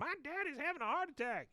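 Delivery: high-pitched mock screaming for help. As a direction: squeal it out like a panicked kid.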